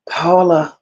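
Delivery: breathy whispered name. Real, not acted. Host leaning in to viewer Paula, whispered. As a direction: whisper her name like a secret.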